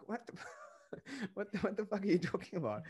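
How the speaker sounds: high, half-laughing disbelief; retold disbelief. Telling the co-host how he reacted, incredulous. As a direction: laugh through the outrage. Retold outrage at racist remark.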